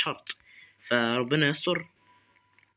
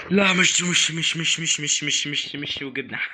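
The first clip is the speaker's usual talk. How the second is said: panic; 2 semitones higher, slower than usual. Real, not acted. Panicking while chased by neighbor.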